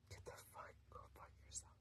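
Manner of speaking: ordinary talk, nothing marked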